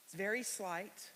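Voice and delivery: ordinary talk, nothing marked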